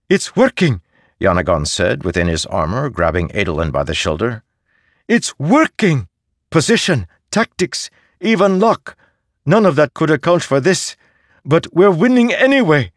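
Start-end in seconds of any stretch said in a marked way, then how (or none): 0.1-0.8 put-on high, eager young man's voice; young prince voice. 5.1-12.9 high, loud, thrilled cry of victory; excited young prince. Acted.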